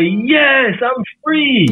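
put-on high little-kid voice, smiling; father imitating his kids, high and beaming; imitating a happy child. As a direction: mimic his small child answering proudly. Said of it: "they'll get this big smile on their face and just say".

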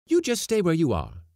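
high, loud command; defiant counter-order. A narrator's reading. Cook telling the men to stay, loud defiant order. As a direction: overrule him sharply, pitched right up.

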